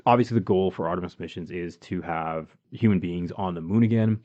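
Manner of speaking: usual speaking voice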